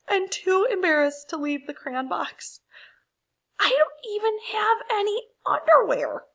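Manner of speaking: high, whiny, near-tearful crayon voice; embarrassed Peach Crayon. Acted. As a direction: plead high and flustered, ashamed to be seen. Naked Peach Crayon pleads.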